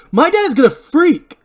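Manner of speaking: high, loud, drawn-out outburst; loud high-pitched freak-out; panicked yell. Acted. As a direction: cry out in alarm, voice way up. Barry panics over crashed car.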